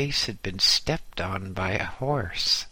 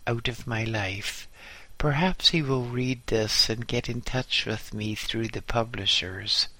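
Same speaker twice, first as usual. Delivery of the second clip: plain reading voice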